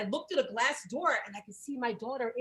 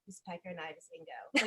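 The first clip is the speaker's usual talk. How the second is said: softer, lower, mouth-only deadpan; Padma impression; 8 semitones lower, 12 dB quieter than usual. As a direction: barely move anything but the mouth. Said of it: "nothing moves but her mouth".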